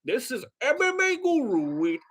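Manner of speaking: hammed-up video intro in a dodgy British accent; M M A Guru. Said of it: "I can't do accents".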